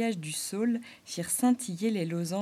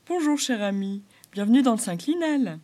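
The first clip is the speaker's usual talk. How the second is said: a beaver's friendly welcoming voice, a bit louder; beaver's greeting; 3 semitones higher, 7 dB louder than usual. Acted. Beaver welcoming the stranger on the riverbank, friendly voice. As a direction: greet the newcomer like a genial old beaver.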